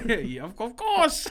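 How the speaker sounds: put-on laughing, high, failed Aussie accent; badly faked Australian waitress; slipping Australian accent. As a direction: lose hold of the Australian accent while cracking up. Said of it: "He just loses the accent sometimes".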